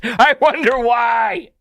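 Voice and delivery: high, slow, loud mock puzzlement; hammed-up sarcastic wondering; loud sarcasm. Real, not acted. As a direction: exclaim it as if the answer were obvious. Sarcastic jab at the mortgage complaint.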